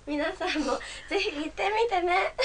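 the voice breaks as she ends; voice cracking. Real, not acted. Closing her pet-dog presentation, voice cracking. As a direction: voice cracks on the last line. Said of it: "最後声がおかしくなっちゃった".

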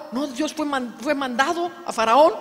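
ordinary talk, nothing marked

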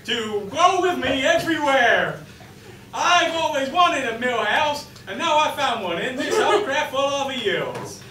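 high-pitched gushing heiress voice; Paris Hilton. Acted. Plays Paris longing for Milhouse.

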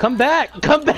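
shouting. Real, not acted. Teammate calling player back.

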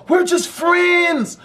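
high, drawn-out mock female voice; hammed-up high-pitched denial; protesting girlfriend. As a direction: mimic her flimsy excuse slowly and high. Mimics the girl's excuse.

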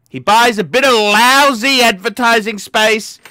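usual speaking voice

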